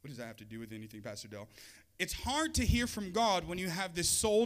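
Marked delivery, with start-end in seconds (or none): 0.0-1.5 low, hushed, quick aside; muttered objection. Acted. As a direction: mutter it as a doubting listener. Voices a doubting listener's question.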